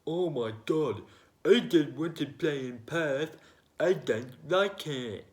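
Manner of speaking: high, loud mock whine; whiny cricketers. Mocking eastern-states players refusing Perth, whiny put-on voice. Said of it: "from the eastern states have said".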